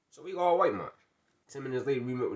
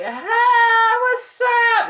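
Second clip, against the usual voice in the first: much higher, loud squealed hello; high girly greeting; 14 dB louder, much slower than usual. Retold or imitated. Girls crossing the street to greet them, squealing. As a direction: squeal it like an overexcited girl.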